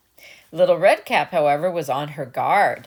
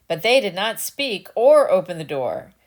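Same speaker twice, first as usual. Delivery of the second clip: usual speaking voice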